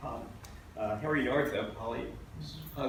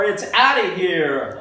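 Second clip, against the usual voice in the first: loud ballpark announcer call; announcer's roar; 7 semitones higher, 15 dB louder, slower than usual. Acted. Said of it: "Announcers roar".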